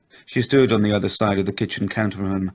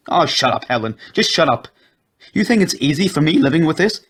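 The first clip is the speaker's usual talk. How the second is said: loud, higher, fed up; angry husband; 8 semitones higher, 5 dB louder than usual. A narrator's reading. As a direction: bark it at her, losing patience. Husband shouting at his wife.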